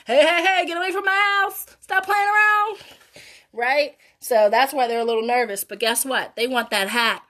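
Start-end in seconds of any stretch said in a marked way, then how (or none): 0.1-2.8 mock yell, higher and louder; grumpy neighbour shouting kids away; retold yelling. Reader imitates the neighbour yelling at the kids. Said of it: "yelling at them".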